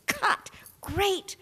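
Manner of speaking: director calling cut. Retold or imitated. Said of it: "He says".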